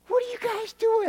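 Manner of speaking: what are you doing, said high and amazed; high incredulous disbelief. Retold or imitated. Preacher baffled watching girlfriend and roommate stand, high disbelief.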